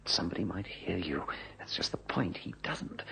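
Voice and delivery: hushed whisper so no one overhears. Acted. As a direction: keep it a secret whisper, barely breathed. Hushed talk so nobody overhears.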